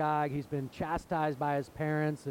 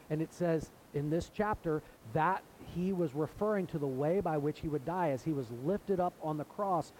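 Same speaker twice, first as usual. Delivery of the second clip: ordinary delivery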